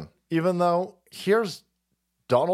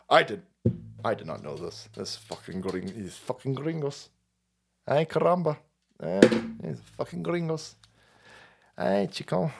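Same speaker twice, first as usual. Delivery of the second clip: put-on low, soft muttered Spanish-accented grumble; mock Spanish accent; 8 semitones lower, 4 dB quieter than usual. Wife muttering about gringos in a low, soft Spanish accent. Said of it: "Salt Queen's sitting there going".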